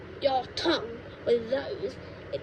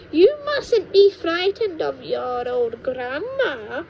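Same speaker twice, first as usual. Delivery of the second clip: higher, louder, slow false comfort; witch grandma coaxing; 5 semitones higher, 5 dB louder, slower than usual. Acted. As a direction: soothe him with menace underneath.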